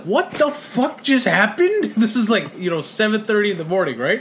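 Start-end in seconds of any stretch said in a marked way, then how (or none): 0.0-1.9 confused. Retold or imitated. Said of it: "I'm like".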